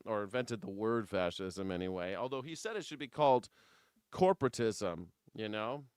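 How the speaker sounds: usual speaking voice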